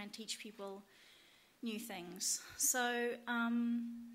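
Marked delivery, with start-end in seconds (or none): none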